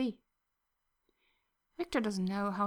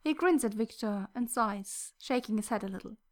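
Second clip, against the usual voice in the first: plain reading voice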